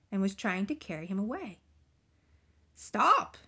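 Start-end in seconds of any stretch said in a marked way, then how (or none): 2.7-3.4 sharp, loud, raised-pitch command; shout. A narrator's reading. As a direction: shout it to halt an attacker. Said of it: "shouted the very old man".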